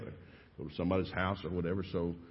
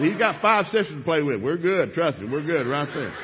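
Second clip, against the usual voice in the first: laughing protest; 6 semitones higher, 8 dB louder than usual. Retold or imitated. His loud answer to wife.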